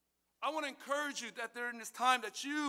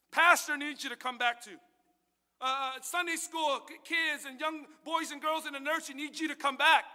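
preaching appeal; 5 semitones higher, 6 dB louder than usual. Acted.

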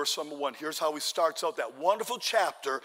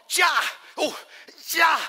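high, loud spear-thrust shouts; sharp acted fighting yells; battle cries; 11 semitones higher, 8 dB louder, slower than usual. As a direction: yell out each stab.

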